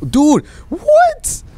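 very high, loud shriek of shock; screeched high what; shrieking disbelief. Real, not acted. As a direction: shriek it in outrage. Yet another non-rare gold.

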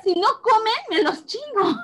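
put-on high teasing threat to the kids; mock threat. Quoting herself to the greedy kids, mock threat. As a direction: play the fed-up mom, jokingly. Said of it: "O sea, les dije".

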